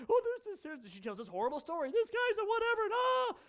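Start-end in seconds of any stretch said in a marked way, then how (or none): 0.1-0.9 high-pitched hammed-up sobbing woman; mock sobbing. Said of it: "a woman comes in sobbing". 1.9-3.4 very high, hammed-up tearful wail; mock sobbing. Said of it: "a woman comes in sobbing".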